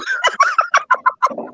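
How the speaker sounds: very high forced cackle; fake laugh. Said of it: "That is the fakest laugh".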